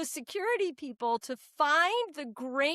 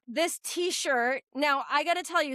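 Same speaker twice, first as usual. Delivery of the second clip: ordinary talk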